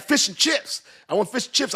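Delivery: high-pitched eager cry; hammed-up high calling out; excited mock call. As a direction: imitate an excited shout for food, way up high. Acting out excited call at the truck.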